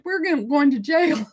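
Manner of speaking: high, half-laughing mock panic, pitched well up; a kid sure he's going to jail, voiced with a laugh; retold childhood panic. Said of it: "I panicked".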